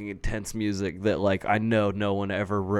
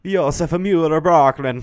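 Josef Mueller-Brockman, higher and louder, in a hammed-up Swedish accent; mock Swedish accent; 6 semitones higher, 4 dB louder, slower than usual. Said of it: "if a Swedish dude was saying".

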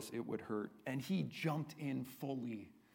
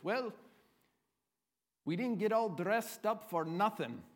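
higher-pitched, put-on Irish accent; 6 semitones higher than usual. Irishman to his comrades before battle, in an Irish accent. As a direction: play the Irish fighter, lilting up in an Irish accent. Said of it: "I'll give you my Irish accent here".

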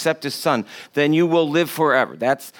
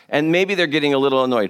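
ordinary talk

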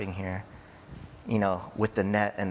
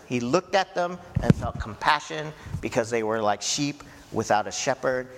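normal talking voice

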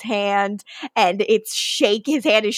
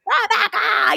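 high mock yell of a name, pitched way up; retold scream; 9 semitones higher than usual. Klaus screaming at Rebecca in the cemetery, high mock yell. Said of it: "He's screaming at Rebecca".

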